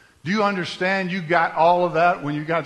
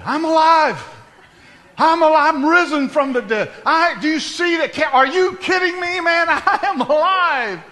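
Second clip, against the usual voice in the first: shouting for joy, much higher and louder; jubilant shout; 13 semitones higher, 9 dB louder than usual. Pretend. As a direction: burst out of the coffin crying out in triumph. Pastor acts rising from casket.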